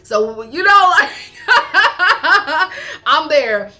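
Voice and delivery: laughing proud vow; excited insistence. Real, not acted. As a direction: declare it with playful defiance. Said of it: "I'm not gonna lie".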